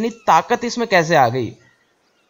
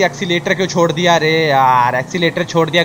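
ordinary talk, nothing marked

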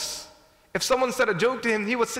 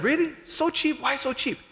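surprised; 6 semitones higher, slower than usual. Retold or imitated. Buyers surprised at the price.

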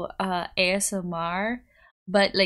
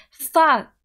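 sudden curse; 6 semitones higher, 10 dB louder than usual. Real, not acted. Sudden outburst.

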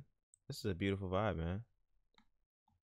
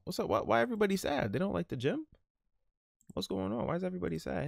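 much higher, puzzled question; high-pitched voice; 10 semitones higher than usual. Pretend. Friend asks why everyone looks sad, in a squeaky high voice.

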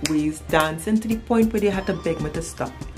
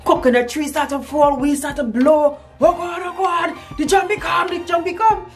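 high-pitched, frantic cries of alarm; hammed-up terror, pitched way up; re-enacted panic; 10 semitones higher, 3 dB louder than usual. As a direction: retell it as a shrieky panic. Re-enacts panic as spirit comes.

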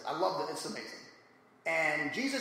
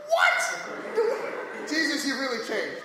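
high, breathy laughing what, then a teasing line; mock shock; 7 semitones higher, 5 dB louder, much slower than usual. Reacting to Jesus with a sword, in laughing mock shock. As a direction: gasp it out through a laugh, half joking. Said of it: "I was like".

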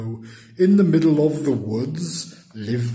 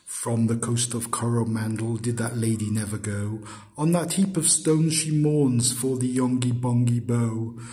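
ordinary delivery, nothing marked

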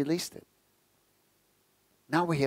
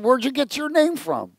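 much higher, incredulous mock question; amazed robber; 11 semitones higher, 4 dB louder than usual. Burglar asks the parrot about its name, in mock disbelief. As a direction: ask it in wide-eyed disbelief.

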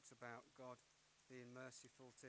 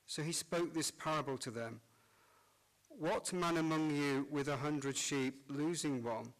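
cathedral echo; 3 semitones higher, 21 dB louder than usual. Acted. Priest proclaims Gospel in church.

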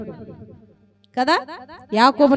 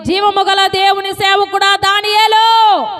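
a slow loud higher call down into the den; king calling out; 6 semitones higher, 4 dB louder, slower than usual. Retold or imitated. King calls Daniel in the lions' den, loud and higher. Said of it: "గబగబా వచ్చి".

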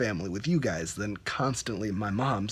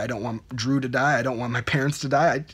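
ordinary talk, nothing marked

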